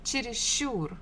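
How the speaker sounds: чересчур with the last syllable ур stressed; stress on final syllable. Shown as a demonstration. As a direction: lean on the ур at the end of чересчур. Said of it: "stress falls on the final syllable".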